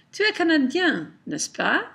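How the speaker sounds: "n'est-ce pas" lifted at the end as a question; rising tag question. Shown as a demonstration. Teacher demonstrates a tag question to the class, rising intonation. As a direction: state it, then ask with a rise on "n'est-ce pas". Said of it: "I raise the intonation".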